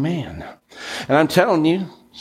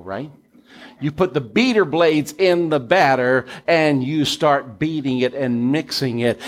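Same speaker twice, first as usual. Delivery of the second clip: ordinary talk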